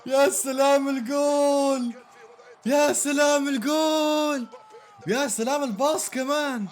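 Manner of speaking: high, slow, loud celebration; stretched ecstatic yells; joyful shouts. Real, not acted. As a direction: bellow the praise. Celebrating goal.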